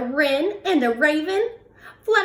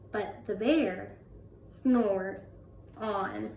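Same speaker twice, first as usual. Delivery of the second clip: softly, slowing down; drowsy whispered-soft refrain; hushed refrain; 3 semitones lower, 8 dB quieter, slower than usual. A narrator's reading. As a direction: let the bear's snoring refrain fade out. Recurring refrain, slow and soft.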